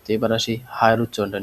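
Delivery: ordinary delivery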